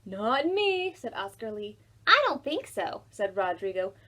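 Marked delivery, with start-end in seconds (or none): 0.0-0.9 put-on higher, louder kid voice; boy's refusal. A narrator's reading. Boy to Ruby, a higher, louder flat refusal. 2.1-3.0 put-on higher, louder dismissive kid voice; boy's refusal. A narrator's reading. Rodrigo to Ruby, a higher, louder dismissive refusal.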